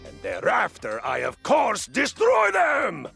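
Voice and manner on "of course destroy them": boastful shout. Acted. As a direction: roar the threat out slowly. Warrior boasts about crushing enemies.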